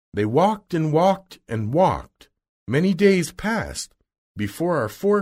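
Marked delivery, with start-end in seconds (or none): none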